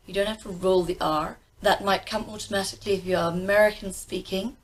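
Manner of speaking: normal talking voice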